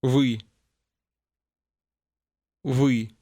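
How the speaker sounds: Вы with the Russian vowel ы, tongue pulled back, lips unrounded; isolated Вы, a short dark ы sound not found in English; teacher demo of the ы in Вы; Russian vowel model. Shown as a demonstration. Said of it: "ends with a sound which does not exist in English".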